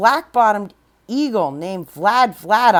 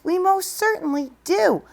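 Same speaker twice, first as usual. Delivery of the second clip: much higher piping reply; piping tiny voice; 9 semitones higher than usual. Acted. The Who mayor answering Horton, high and piping. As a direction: pipe it up like a speck-sized mayor. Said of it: "piped the voice".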